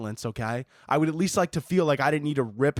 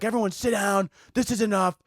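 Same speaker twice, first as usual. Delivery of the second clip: higher-pitched mild mock yell; retold teacher's scream; 7 semitones higher than usual. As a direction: imitate a fed-up teacher without full volume. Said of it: "scream and be like".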